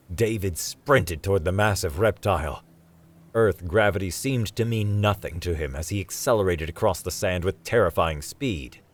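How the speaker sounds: ordinary delivery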